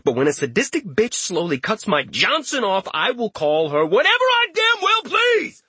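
rising to a high, furious yell; furious shout. Acted. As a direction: explode at them in rage. Richter's rage peaks.

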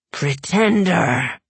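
slow drawn-out demon howl. A narrator's reading. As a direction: howl it as a dark voice. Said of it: "howled the dark voice".

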